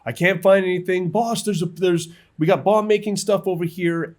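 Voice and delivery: high, loud call to the boss; soldier calling out. Retold or imitated. Searching soldier calls out to the boss, high and loud. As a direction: shout the find across to the boss.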